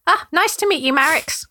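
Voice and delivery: sorry, embarrassed little brother; apologetic gnome Trevor. Acted. Trevor greeting.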